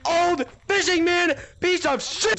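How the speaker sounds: loud, high-pitched insult barrage; exasperated rant. Real, not acted. Berating the player who got him, exasperated yelling. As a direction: build to a slow, punched-out insult.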